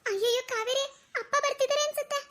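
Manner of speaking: high-pitched worried warning; alarmed. Acted. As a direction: warn your friend in a high, anxious voice. Girl fears father is coming.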